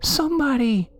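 put-on very high, thin lost-child voice; tiny high-pitched whimper; whimpering little girl. A narrator's reading. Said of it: "it whimpered".

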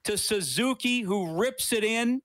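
excited live goal call; play-by-play call. Acted. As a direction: call it like the goal just happened.

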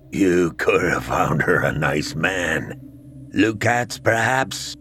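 low, slow reproachful dead father; a deep, slow reproachful ghost; ghostly father voice. A narrator's reading.

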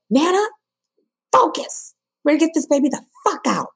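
high, urgent doctor voice; imitating the doctor urging the push; doctor taking charge. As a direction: bark orders at the mother in labour. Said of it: "And she was like".

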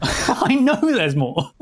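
slow, giggling through it; laughing. Real, not acted. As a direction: drag it out while laughing. Second take, slow and laughing.

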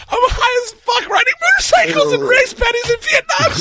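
high, crying with laughter; helpless laughing retelling. Real, not acted. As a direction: wheeze the words through laughter.

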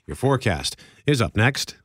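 normal talking voice

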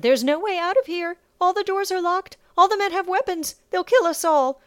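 put-on high, loud, panicky Cardathian accent. A narrator's reading. Cowering slave girl warns Sasha, panicked Cardathian accent. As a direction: protest in alarm with a Cardathian accent. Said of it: "said in a Cardathian accent".

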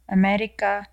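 ordinary talk, nothing marked

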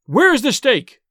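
high, loud demand; furious cry. A narrator's reading. Son hunting for the hidden stake, furious cry. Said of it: "returned with fury in his eyes".

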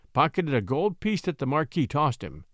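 usual speaking voice

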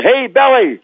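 high-pitched mock heckle; teasing call. Co-stars teasing Lugosi on the movie set, high teasing call. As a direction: call out a nickname to needle someone. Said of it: "teased him with that name".

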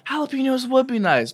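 high-pitched, hyped-up reply; mock excitement over the food; hyping up. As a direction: pitch jumps up, relishing the order. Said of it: "hypes up your meal".

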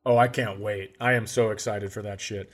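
usual speaking voice

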